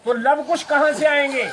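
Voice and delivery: loud, high rhetorical question; emphatic retort. Acted. Dasharath challenging the bride's father, an emphatic retort.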